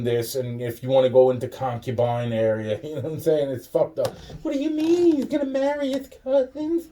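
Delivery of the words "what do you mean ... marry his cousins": high, squeaky mock disbelief; incredulous mock question. Mocking an outsider's shocked question about cousins.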